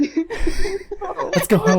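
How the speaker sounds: high, drawn-out laughing words. Real, not acted. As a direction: laugh it out slowly. Laughing mid-quote.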